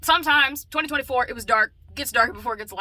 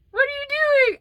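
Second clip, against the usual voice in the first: mock shocked question; 8 dB louder, much faster than usual. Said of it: "pretend scolding child".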